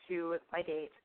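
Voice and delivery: ordinary delivery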